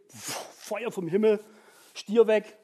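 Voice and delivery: ordinary talk, nothing marked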